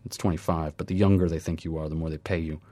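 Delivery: plain reading voice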